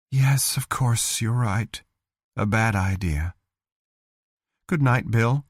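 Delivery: resigned sigh. Acted. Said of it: "Another sigh".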